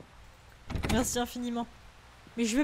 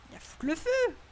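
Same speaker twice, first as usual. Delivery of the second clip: high alarmed cry; 9 semitones higher than usual. Real, not acted. Panicking at fire in game.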